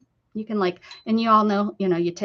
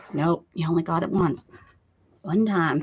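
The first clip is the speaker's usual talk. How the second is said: normal talking voice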